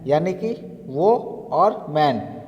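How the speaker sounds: वो and मैन said slowly as separate parts; slow syllable split. Shown as a demonstration. Teacher splitting a word into syllables, slow and deliberate. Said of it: "दूसरा word है वो मैन".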